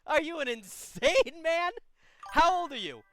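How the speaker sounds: rising outrage at a friend's bad choice; exasperated disbelief. Real, not acted. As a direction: lay into him, unable to believe it. Advisor exasperated at caller's choice.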